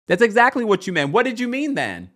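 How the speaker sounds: high, loud, quick challenge; indignant retort. Pretend. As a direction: call out the excuse, pitch climbing. Indignantly answering the man's excuse.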